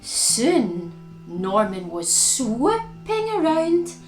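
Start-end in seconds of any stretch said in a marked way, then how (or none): none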